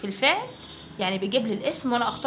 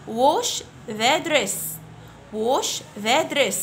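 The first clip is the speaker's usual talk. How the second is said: put-on very slow, clear drill phrase for children to repeat; slow model phrase; much slower than usual. Shown as a demonstration. Teacher to fifth graders, model phrase very slowly. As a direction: stretch each word out for the class. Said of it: "Repeat after me".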